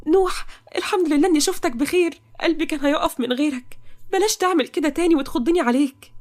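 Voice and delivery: high-pitched, crying with relief; tearful relief. Acted. Toqa at Nouh's side once he wakes, tearful relief. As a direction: scold him lovingly through tears. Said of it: "وهي بتعيط".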